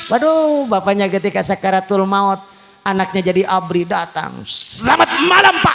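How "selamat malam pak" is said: high, loud barked greeting; loud soldier greeting. Acted. Soldier son greets dying father.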